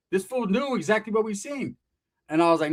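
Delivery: normal talking voice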